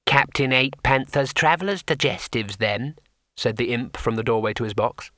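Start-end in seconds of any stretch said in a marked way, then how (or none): 0.1-3.0 a small painted imp, unhurried; tiny imp. Acted. Imp settling the matter for the wizard, small creature voice.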